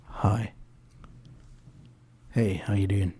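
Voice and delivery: soft, intimate crooning into the mic; seductive murmur. Shown as a demonstration. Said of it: "Stop trying to make love to the microphone".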